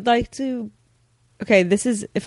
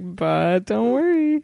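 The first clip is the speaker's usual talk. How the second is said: drawn-out mock reassurance; playful reassurance; much slower than usual. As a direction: stretch it out slowly, teasing the servants. Queen reassures her pranked servants.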